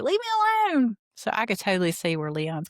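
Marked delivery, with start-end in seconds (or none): 0.0-1.0 very high mock-whiny teen, a bit louder; sulky daughter imitation. Mocking her daughter pushing mom away, very high and whiny. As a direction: whine it like a fed-up teenager. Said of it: "and she'd be like".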